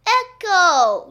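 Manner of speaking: high, loud holler; yelling. A narrator's reading. Boy testing the gym's echo, yelling. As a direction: yell it out to hear it bounce back. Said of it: "yelled Michael".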